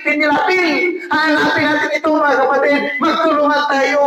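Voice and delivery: high, rousing call to the congregation; rallying preaching. Real, not acted. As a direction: fire them up to search together.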